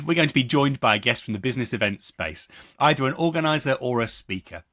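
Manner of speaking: ordinary delivery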